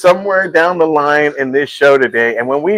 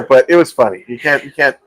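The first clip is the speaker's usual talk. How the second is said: normal talking voice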